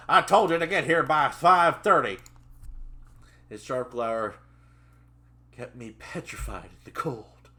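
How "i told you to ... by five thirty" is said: loud, raised Southern scolding; Southern accent growl. Acted. Trainer scolding his late trainee, angry Southern shout. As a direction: berate them in a Southern accent. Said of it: "his southern accent growled".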